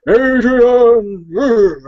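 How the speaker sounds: slow, loud, high Rocky-style cry; Sylvester Stallone impression. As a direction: drag out the name and call it loud. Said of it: "What about Sylvester Stallone?".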